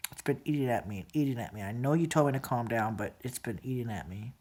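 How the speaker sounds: words fading under the weight; hushed hurt. Real, not acted. Telling a relative it still gnaws at her, hushed.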